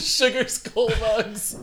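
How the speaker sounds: laughing. Real, not acted. Echoing amused.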